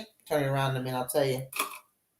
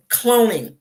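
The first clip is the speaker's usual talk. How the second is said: 大声喊出cloning; loud cloning; 3 semitones higher, 10 dB louder than usual. Acted. Shouted repeat of a word.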